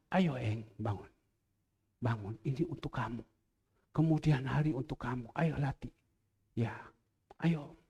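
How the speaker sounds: soft, low coaxing to get up; a father quietly waking his daughter; gentle waking. Retold or imitated. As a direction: gently rouse a sleepy child. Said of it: "kadang mau bangun itu berat".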